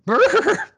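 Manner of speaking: high squeaky laugh. Real, not acted. Laughing at own bit.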